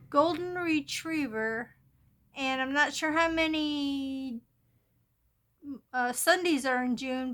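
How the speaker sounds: usual speaking voice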